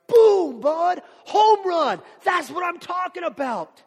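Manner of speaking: Jesus hollering his joy at Peter; excited shout. Acted. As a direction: cheer like a home run was hit. Jesus cheering Peter's answer.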